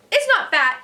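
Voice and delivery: high, loud defensive shout. A narrator's reading. Narrator shouting at Sheila to defend Izzy. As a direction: snap back hard. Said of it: "I shouted".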